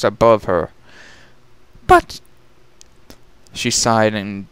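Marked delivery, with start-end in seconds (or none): 1.9-2.2 much higher, dazed posh pony voice; dazed lady. Acted. Rarity coming to in a dark cavern, higher and dazed. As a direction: wonder where you are.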